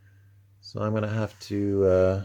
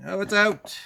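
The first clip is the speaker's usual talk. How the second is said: excited; 9 semitones higher, 3 dB louder than usual. Real, not acted. Pops a part free, exclaims.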